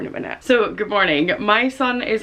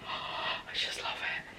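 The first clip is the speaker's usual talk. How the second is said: whisper; 16 dB quieter, slower than usual. Real, not acted. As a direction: gasp then soft whisper. Feeling soft jumper.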